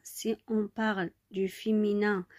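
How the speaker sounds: ordinary talk, nothing marked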